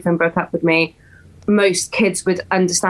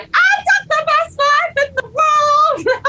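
much higher, slow, ecstatic proclaim; euphoric; slower than usual. Retold or imitated. Describing her manic high, crying out in euphoria. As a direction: announce your joy to the world. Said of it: "Now I'm like".

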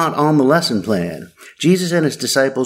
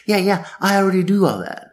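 quick, higher-pitched mock frat boy; eager interruption; 5 semitones higher, faster than usual. Rich frat boy interrupting Jesus, eager and dismissive. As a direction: imitate an over-eager frat boy cutting in. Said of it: "golden retriever interrupts Jesus and says".

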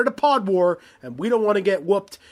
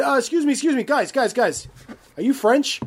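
calling out; 7 semitones higher than usual. Retold or imitated. Acting out friend hailing French players.

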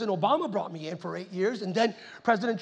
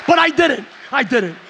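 loud denial; 3 semitones higher, 10 dB louder, slower than usual. Real, not acted. Joking denial to laughing crowd.

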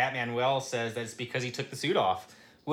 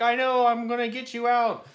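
determined shout; 9 semitones higher, 5 dB louder than usual. Retold or imitated. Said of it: "And he's like".

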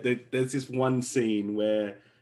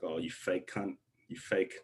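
muttered mock disgust; 4 semitones lower, 8 dB quieter than usual. Muttered retort to fake movie stars.

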